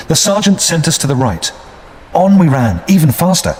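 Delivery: usual speaking voice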